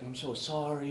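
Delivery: mock insincere "I'm so sorry" in someone else's voice; half-hearted apology. Roommate apologizing for the speaker, mock half-hearted sorry. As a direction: fake regret with crocodile tears. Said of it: "half-hearted, crocodile tears".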